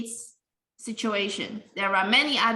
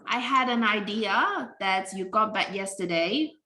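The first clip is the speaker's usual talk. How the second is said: ordinary delivery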